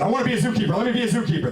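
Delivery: high, eager demand; eager plea. Retold or imitated. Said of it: "I said".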